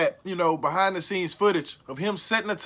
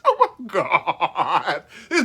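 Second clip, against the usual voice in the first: laughing; 4 semitones lower, much slower than usual. Real, not acted. Laughing at the salt clip.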